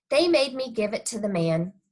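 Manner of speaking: ordinary delivery, nothing marked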